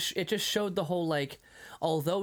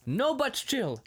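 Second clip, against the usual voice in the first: mock shopkeeper; 9 semitones higher, slower than usual. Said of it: "And the other one".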